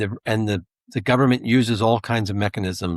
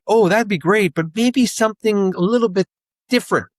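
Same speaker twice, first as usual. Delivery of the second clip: much higher, louder, hinting mailman voice; parched mailman; 9 semitones higher, 3 dB louder than usual. Retold or imitated. Mailman hinting to the kid that he's thirsty, high and hammed-up. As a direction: fish for a drink, voice pitched way up.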